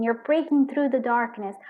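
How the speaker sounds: normal talking voice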